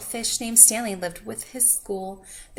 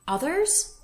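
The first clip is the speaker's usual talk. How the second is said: high, rising surprised ask; surprised question; 7 semitones higher than usual. A narrator's reading. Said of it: "asked Stanley".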